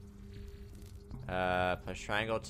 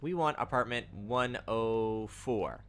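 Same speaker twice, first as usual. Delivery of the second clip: ordinary delivery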